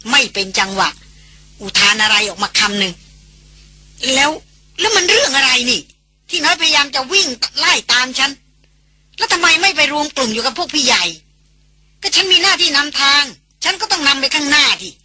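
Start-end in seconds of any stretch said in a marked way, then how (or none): 3.5-14.9 put-on a defensive young guide, higher, questioning; why chase me, indignant; puzzled guide. A narrator's reading. Guide asks why she was followed, puzzled and defensive. Character protests being chased.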